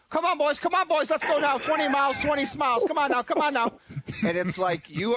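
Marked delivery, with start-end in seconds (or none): 0.1-3.7 much higher, louder hammed-up rally cry; fake rah-rah cheer. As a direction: pump up the boys like a phony leader. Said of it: "then he's like".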